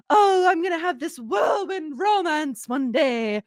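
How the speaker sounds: put-on dreamy British accent, slow and high. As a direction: swoon it in a British accent like a romantic teen. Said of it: "I don't know why I sounded, like, British".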